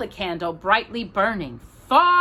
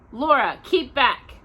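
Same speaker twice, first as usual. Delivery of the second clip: higher, slow firm command; firm order; 6 semitones higher, 4 dB louder, slower than usual. A narrator's reading. Ma orders Laura away from the well, higher and slow. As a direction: order the child back.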